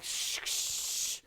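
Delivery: soft unvoiced shh of a spray can; imitated spray hiss. Host mimicking the old Fabulon spray for viewers, soft hiss. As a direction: breathe out a hissing spray sound twice. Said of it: "Fabulon was like".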